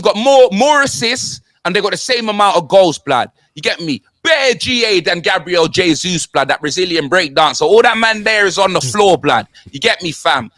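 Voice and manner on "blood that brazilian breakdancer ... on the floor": ordinary talk, nothing marked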